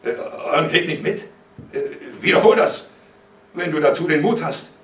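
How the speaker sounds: affronted father, voice rising; incredulous challenge. A narrator's reading. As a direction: dare him to say it. Incredulous father challenges son to repeat.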